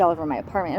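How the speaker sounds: normal talking voice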